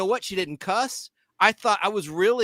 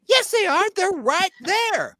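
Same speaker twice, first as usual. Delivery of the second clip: much higher and louder, slowing on the last words; indignant customer; 12 semitones higher, 4 dB louder, slower than usual. Retold or imitated. Woman demanding rolling papers from the clerk, high indignant voice. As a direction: play the outraged lady, pitched way up.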